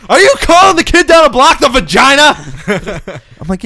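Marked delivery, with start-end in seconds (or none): 0.1-2.3 loud, high mock-furious father; angry dad yell. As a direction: imitate a dad exploding at his kid. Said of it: "my dad beat the shit out of me".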